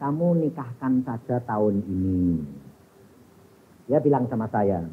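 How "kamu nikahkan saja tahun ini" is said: low, soft, weighty delivery; hushed, grave instruction to a parent; solemn quoted instruction. Retold or imitated. Preacher retelling what was said to marry off the children, solemn and hushed.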